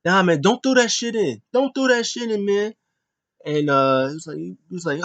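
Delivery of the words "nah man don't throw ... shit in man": higher, heated pleading; mock angry protest at his brother; imitating an upset brother swearing; cursing. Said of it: "and then Jay's cursing".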